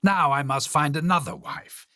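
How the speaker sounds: a king groaning that his wife is a nuisance; fed-up king crying out. Acted. King complaining about his dead wife, exasperated. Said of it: "up and cried".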